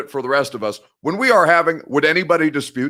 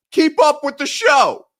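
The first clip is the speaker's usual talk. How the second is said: much higher and slow, condescending; mocking jab; 12 semitones higher, 4 dB louder, slower than usual. Real, not acted. Host sneering at a viewer who got it wrong, high and slow. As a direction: talk down to the commenter.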